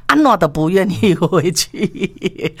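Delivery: slow, breaking into a laugh. Real, not acted. Storyteller tells of ex refusing to go back, laughing. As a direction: let the line dissolve into real laughter.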